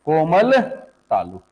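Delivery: stretched out, with a gap between words; slow emphatic repeat. Real, not acted. Teacher repeating the key term for students, slow and deliberate. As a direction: draw the term out slowly.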